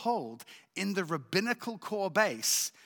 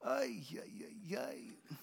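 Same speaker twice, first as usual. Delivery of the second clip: whispered lament; 3 semitones higher, 12 dB quieter, slower than usual. Retold or imitated. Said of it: "So I was going".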